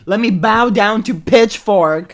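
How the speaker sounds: loud, slow, high mock worship; bow down with heavy sarcasm; sarcastic reverence.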